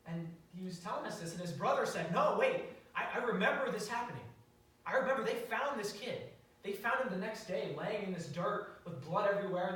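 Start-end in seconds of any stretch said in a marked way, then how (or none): 2.1-9.7 loud, higher-pitched retold interjection; excited, raised-voice correction; urgent realization. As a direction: burst in with a sudden memory.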